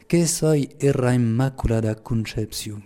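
slow, measured line in Lourdes patois; Pyrenean patois. Shown as a demonstration. Speaker quoting Mary to deepen the riddle, in patois. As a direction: say it deliberately with a Pyrenean patois pronunciation. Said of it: "je vais le dire en patois".

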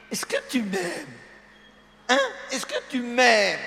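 a wife fishing for love; coaxing wife. Retold or imitated. Priest mimics a wife asking her husband if he loves him.

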